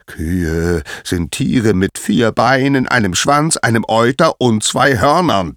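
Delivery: booming, irritated explanation; thundering anger. A narrator's reading. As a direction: spell it out furiously, item by item. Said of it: "donnerte Apollon".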